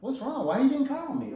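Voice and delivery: high-pitched wounded questioning; puzzled, miffed wife. Acted. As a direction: voice a wife fretting over a missed call. Wife waiting for husband's missing call.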